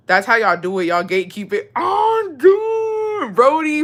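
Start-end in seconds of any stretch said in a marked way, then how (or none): 1.7-3.8 high, loud burst of hype; excited shout. Real, not acted. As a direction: yell it out in sudden excitement. Excited shout to viewer Brody.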